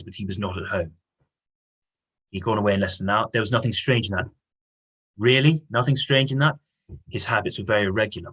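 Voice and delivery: reading the novel aloud to the class, lightly voicing the speakers; a classroom read-aloud with mild character voices; lightly acted read-aloud of dialogue; story reading. Teacher reads novel dialogue aloud.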